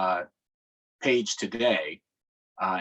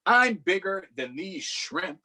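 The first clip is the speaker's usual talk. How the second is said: booming boastful squid; 8 semitones higher, slower than usual. Acted. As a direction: stretch it out, proud and huge.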